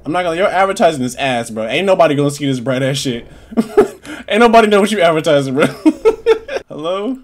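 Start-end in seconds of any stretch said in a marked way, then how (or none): 3.3-4.2 breathless, voiceless wheeze; real amused wheeze; wheezing laugh. Real, not acted. Laughing at game's bright advert. 5.7-6.6 high, loud burst of laughter; real high cackle; cackling laugh. Real, not acted. Laughing at his own joke.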